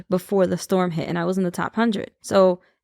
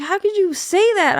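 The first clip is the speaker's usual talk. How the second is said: mock hurt friend; 10 semitones higher than usual. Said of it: "she was like".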